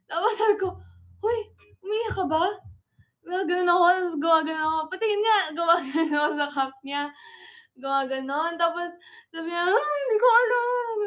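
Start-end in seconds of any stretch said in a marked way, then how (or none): 1.2-2.4 high, raised-voice retold question; startled question. Asking friend if crying, high and surprised. Retelling noticing friend's tears. 4.9-5.4 high, loud playful demand; insistent demand. Retold or imitated. Gesturing to see crying friend, loud and insistent. 9.6-11.0 high, loud tearful wail; mock crying. Imitating crying friend who doesn't know, high wail. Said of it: "tas umiiyak na siya".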